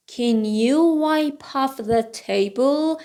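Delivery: slow, drawn-out learner's model; slow model reading. Shown as a demonstration. Teacher repeats the books sentence for learners, slowly. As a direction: give each word room, slowly.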